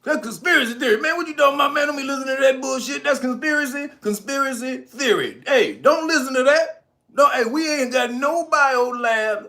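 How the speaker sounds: high, loud mocking denial; hammed-up high denial voice; mocking the deniers. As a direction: parody a dismissive official waving it off. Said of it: "And so that was".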